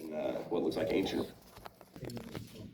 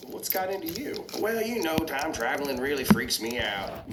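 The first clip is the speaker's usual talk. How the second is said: lightly acted, higher-pitched cartoon voice; character voice; 9 semitones higher than usual. One car confides a fear of time travel, character voice. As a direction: raise the pitch for a worried character.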